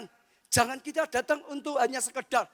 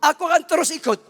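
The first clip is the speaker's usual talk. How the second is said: stubborn vow; 5 semitones higher, 9 dB louder than usual. Acted. Elisha insists stubbornly.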